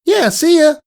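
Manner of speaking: high, loud cheery shout; calling goodbye. Acted. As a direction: call out goodbye across the schoolyard. Calling goodbye across yard.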